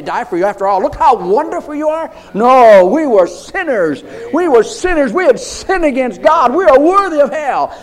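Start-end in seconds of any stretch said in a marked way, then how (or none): 0.8-2.1 mock boast. Mocking the proud listener. 2.3-7.8 loud, high fervent preaching; full-force preaching. Acted.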